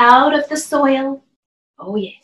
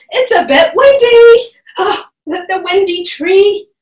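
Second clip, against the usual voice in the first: playful excitement; 5 semitones higher, 6 dB louder than usual. Pretend. Windy tree balancing joke.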